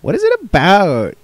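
put-on boy in the play asking his mother; boy actor asking. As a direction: ask it like a kid on stage. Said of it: "the boy on stage asked".

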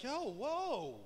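quiet, fast aggrieved protest from the sons; sons' mock complaint about the lost inheritance; whiny sons protesting. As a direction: rattle it off softly like a stunned kid. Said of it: "they're like".

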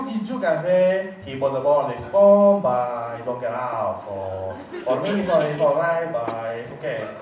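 Spanish commentator in thick mock Spanish accent. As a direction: speak English as if it were Spanish. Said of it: "they still sound like they're speaking Spanish".

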